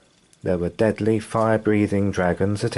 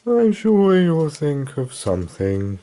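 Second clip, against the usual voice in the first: sleepy yawning; 7 semitones higher than usual. Acted. Dragon yawned.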